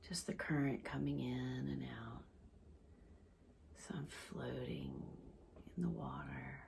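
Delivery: soft, hushed meditation voice with long pauses; guided visualization. Real, not acted. Leads a floating guided visualization.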